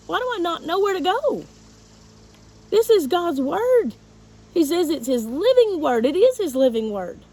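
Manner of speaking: reliving the panic, voice climbing high and loud; frantic retold thoughts.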